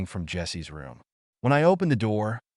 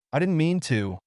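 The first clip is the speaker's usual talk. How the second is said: ordinary delivery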